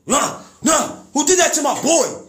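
high, loud, fast angry father; furious dad voice. Retold or imitated. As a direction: play the dad who won't have it, shouting about his son.

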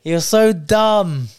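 slow, drawn out through giggles; playful laughing insult. Real, not acted.

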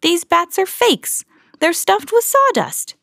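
high, slow, excited discovery; excited cry. A narrator's reading. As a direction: show off the discovery gleefully. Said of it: "cried Mickey".

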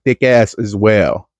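ordinary talk

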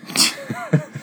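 high burst of laughter. Real, not acted. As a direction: laugh it off, embarrassed. Laughing after admitting crying.

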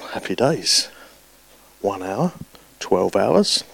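hushed, secret glee; whispered inner thought. Retold or imitated. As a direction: whisper it to yourself as if gloating. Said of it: "are thinking".

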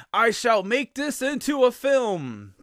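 high, loud, drawn-out mock proclamation; grand mock declaration. Producer declaring his plan for the book, hammed-up grand proclamation. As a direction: declare it with hammed-up pomp, stretching the words. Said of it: "reads this book and declares".

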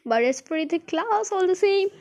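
high-pitched breezy reassurance; cheerfully unconcerned. A narrator's reading. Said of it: "cheerful as ever".

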